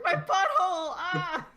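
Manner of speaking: loud, high wail through laughter; hammed-up cry of pain; mock agony. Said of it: "rolling and acting like".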